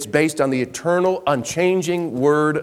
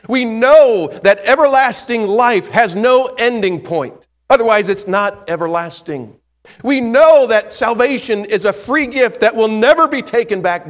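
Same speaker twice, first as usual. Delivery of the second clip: preaching at full force; 8 semitones higher, 7 dB louder than usual. Acted. Preacher rises again on assurance.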